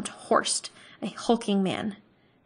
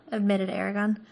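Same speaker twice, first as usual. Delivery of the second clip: plain reading voice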